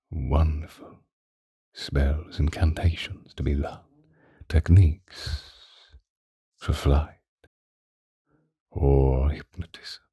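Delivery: hushed whisper as if sharing a secret; secretive whisper. Acted. Tempting Drew with forbidden magic, whispering. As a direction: let it drop to a conspiratorial whisper.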